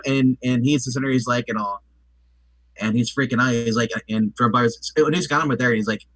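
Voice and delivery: ordinary delivery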